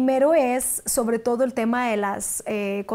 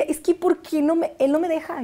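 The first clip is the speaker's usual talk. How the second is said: higher-pitched, indignant protest; retold angry complaint; 6 semitones higher than usual. Actress retelling not being allowed castings, indignant. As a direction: complain with mild retold anger, voice raised in pitch. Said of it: "estaba muy enojada".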